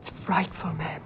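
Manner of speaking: hushed horror; a scared maid's warning in a whisper; fearful whisper. Acted. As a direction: whisper it like a secret. Maid fears the room.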